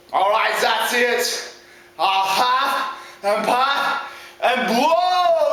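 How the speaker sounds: very slow, drawn-out threat; deliberate storybook villain; fed-up big bad wolf. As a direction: stretch huff, puff and blow. Wolf loses patience.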